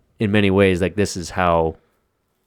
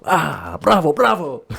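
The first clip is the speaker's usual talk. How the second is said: put-on Italian accent; 10 semitones higher, slower than usual. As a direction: cheer it like an Italian. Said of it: "actual guy who probably is Italian".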